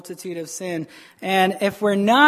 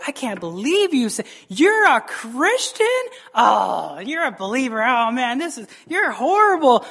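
judgmental outrage; 3 semitones higher than usual. Acted. Believer jumping all over one who stumbled, scandalized. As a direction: pile on with mock scandalized disbelief. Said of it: "jump all over people".

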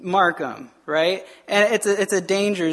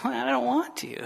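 soft, reluctant whine; played-up half-hearted reluctance; mock spiritual laziness; 3 semitones higher, 7 dB quieter than usual. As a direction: grumble it softly like a lazy believer. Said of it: "it's like that spiritual laziness".

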